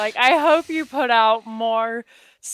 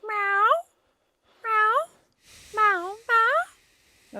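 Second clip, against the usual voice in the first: small high-pitched meows; imitating a cat; 10 semitones higher than usual. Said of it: "he goes".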